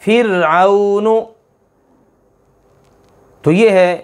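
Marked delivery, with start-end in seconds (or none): 0.0-1.2 फिरऔनु slow, thin ra; thin ra example. Shown as a demonstration. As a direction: stretch फिरऔनु with a light ra. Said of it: "जैसे कुरान पाक में है". (फिरऔनु धीरे, बारीक रा से)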